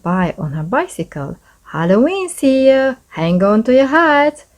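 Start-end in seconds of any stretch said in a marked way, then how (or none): 1.7-4.4 higher and a bit louder, calling out a happy warning; cheerful shout. A narrator's reading. Missus Rankins calls out from her bicycle, cheerful shout. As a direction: call it out cheerily as you ride by. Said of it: "she shouted".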